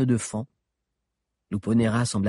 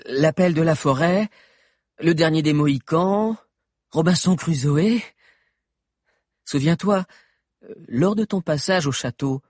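put-on higher youthful boy voice; teen boy voice; 7 semitones higher, 5 dB louder than usual. A narrator's reading. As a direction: voice an eager teenage boy. Valère's character voice.

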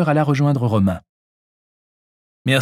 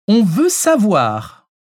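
high, slow, insistent demand; 9 semitones higher, 4 dB louder, much slower than usual. A narrator's reading. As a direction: demand it, stretching the words out. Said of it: "le somma Chantal". (sommation insistante)

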